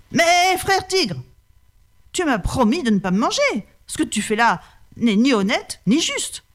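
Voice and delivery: reproachful holy man; pleading brahman. Acted. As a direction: beg the tiger to keep his word.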